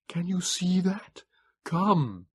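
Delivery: slow, high, thin creature voice; frail Morlock begging him into shelter; pleading Morlock. A narrator's reading. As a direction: plead gently but urgently. Morlock figure.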